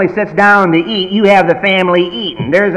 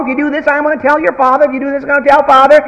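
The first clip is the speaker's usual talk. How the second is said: high, fast nagging mom threat; mother threatening her child, high fast nagging; 9 semitones higher, faster than usual. Retold or imitated. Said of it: "She threatens all day long".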